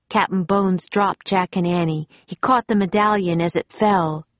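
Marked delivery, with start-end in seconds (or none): none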